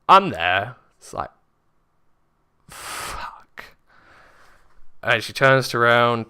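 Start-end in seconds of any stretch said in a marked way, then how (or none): 2.7-3.8 whispered curse; hushed, retold dismay. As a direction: let the swear out in a soft whisper.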